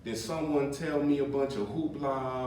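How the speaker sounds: ordinary delivery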